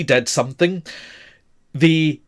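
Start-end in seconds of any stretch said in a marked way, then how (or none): none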